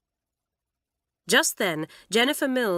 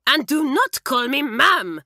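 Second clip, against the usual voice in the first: loud, high, slow French outburst; angry cry; 8 semitones higher, 8 dB louder than usual. A narrator's reading. Mamselle losing control of the situation, furious cry. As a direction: lose her temper, stressing not. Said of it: "cried Mamselle".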